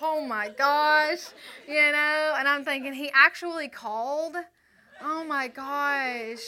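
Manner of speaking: loud, high, drawn-out oh my gosh; giddy disbelief. Retold or imitated. She hears he called, loud giddy disbelief. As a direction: gasp it out, overwhelmed with excitement.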